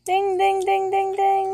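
high, loud game-show bell chant; ding ding bell. Pretend. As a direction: ring out like a quiz bell. Correct-answer bell call.

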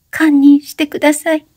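high teary plea for forgiveness; crying plea in a high voice; tearful plea. A narrator's reading. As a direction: beg through tears. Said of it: "泣き声の中で、おしのはおろおろとささやいた".

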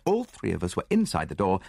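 ordinary delivery, nothing marked

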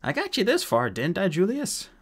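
high, whiny pout. A narrator's reading. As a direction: protest like someone hurt and sulking. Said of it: "pouted Mulch".

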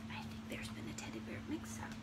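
very soft, confiding whisper; whispered aside. Real, not acted. Confiding aside to young listeners.

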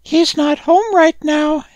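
very high, squeaky little animal voice; high-pitched cartoon rodent; Manila Chinchilla. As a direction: pipe it up like a tiny creature. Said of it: "said the Manila Chinchilla".

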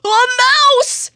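very high, loud shriek of fright; terrified cry. Acted. Elephant spotting the mouse, shrieking in high fright. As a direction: shriek it like a panicked giant. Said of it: "cries Elephant".

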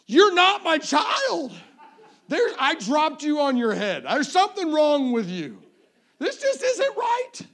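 loud, high, drawn-out comic rant; mock outraged disbelief. Dad disowning too-kind daughter, mock outrage. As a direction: shout it in hammed-up horror.

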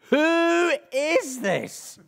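high, drawn-out astonished cry; hammed-up terror, stretched out slowly; mock terrified. Disciples aghast at who calmed the storm, mock terrified.

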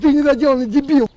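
ordinary delivery

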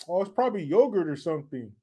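usual speaking voice

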